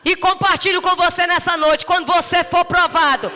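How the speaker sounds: loud, high, fervent preaching; rising revival sermon; preaching at full force. Acted. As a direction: preach it out to the whole church.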